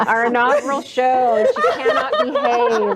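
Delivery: high laughing tease. Real, not acted. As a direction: giggle while teasing. Host teasing cohost, laughing.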